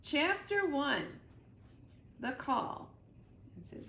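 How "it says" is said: usual speaking voice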